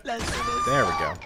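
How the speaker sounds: stretched high and slow; high drawn-out sing-song. Shown as a demonstration. Gamer cheering his win in a stretched high voice.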